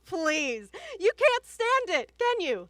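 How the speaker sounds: high, loud, contemptuous tirade; scornful yelling. Acted. Wife belittling small-town professor husband, scornful yelling. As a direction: escalate to a furious yell.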